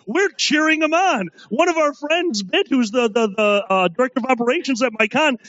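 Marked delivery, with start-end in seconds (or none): none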